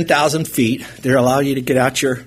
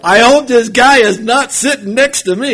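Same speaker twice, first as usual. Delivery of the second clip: high, loud worried plea; anxious plea; 9 semitones higher, 7 dB louder than usual. Retold or imitated. Passenger praying about who sits beside him, anxious plea. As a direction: dread pushed up high and loud.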